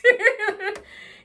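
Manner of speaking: high, loud laugh breaking out; burst of laughter. Real, not acted. Mother laughing as she retells her comeback. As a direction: laugh out loud at your own joke.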